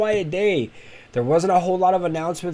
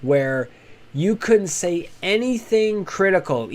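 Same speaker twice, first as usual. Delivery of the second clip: normal talking voice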